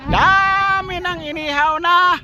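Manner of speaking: high, drawn-out holler; sing-song announcing cry; calling out. Pretend. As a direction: call it out high, stretching the words. Calls out the roast pig boast.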